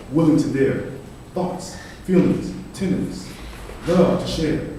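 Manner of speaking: measured, declamatory poetry reading; poem recitation. Acted. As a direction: recite it as an earnest address to an audience. Reads own poem aloud.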